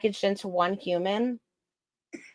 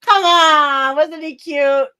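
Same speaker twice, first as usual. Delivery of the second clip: pleading cute; 6 semitones higher, 7 dB louder, slower than usual. Real, not acted. Urging friend.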